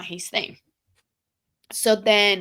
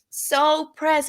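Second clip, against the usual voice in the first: higher, louder repeat of pressed; 7 semitones higher, 4 dB louder than usual. Real, not acted. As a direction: punch the word pressed up high.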